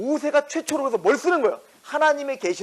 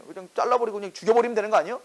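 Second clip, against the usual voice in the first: ordinary talk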